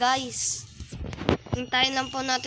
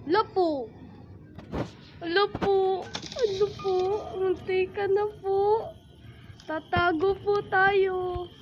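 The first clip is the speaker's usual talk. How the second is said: scared small voice; 5 semitones higher than usual. Pretend. Scared hiding from Granny.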